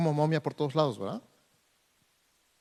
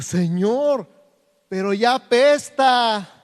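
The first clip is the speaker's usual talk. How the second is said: queja de Marta; 7 semitones higher, 7 dB louder, slower than usual. Retold or imitated. Marta objects to opening tomb.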